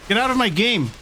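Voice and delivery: loud angry command; shouting. Real, not acted. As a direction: yell him out of the game. Yelling at an invader.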